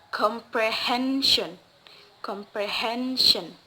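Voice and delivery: comprehension slowly, HEN held; slow compre-HEN-sion, weight on HEN; stress on second-last syllable. Shown as a demonstration. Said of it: "placed on the second to the last".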